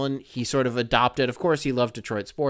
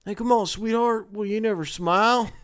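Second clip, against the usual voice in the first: higher, lightly put-on catcaller voice; mock catcaller; 8 semitones higher than usual. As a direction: tease like a sleazy guy telling her to smile. Mocking a catcaller's demand to smile.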